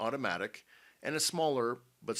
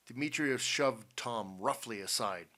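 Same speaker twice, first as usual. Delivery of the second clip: ordinary delivery, nothing marked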